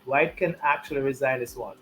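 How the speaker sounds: ordinary talk, nothing marked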